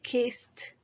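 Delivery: "kissed" as "kist", the t released; audible final T. Shown as a demonstration. Teacher shows pupils a silent e, audible final T. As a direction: make the t at the end of "kissed" heard. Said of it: "Se tiene que oír la T".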